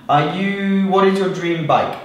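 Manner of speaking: ordinary talk, nothing marked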